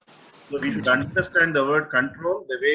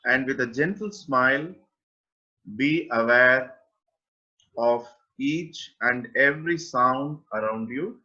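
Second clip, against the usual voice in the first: guided meditation. Acted. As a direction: guide listeners gently into awareness, with long pauses. (unhurried guided-meditation delivery, phrase by phrase)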